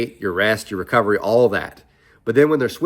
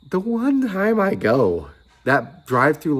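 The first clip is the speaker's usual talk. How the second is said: exasperated; 7 semitones higher, slower than usual. Real, not acted. Exasperated build-up.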